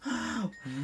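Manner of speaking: sharp, quiet startled gasp. Acted. Toys startled by a slurping noise, sharp gasp. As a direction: catch the breath in fright.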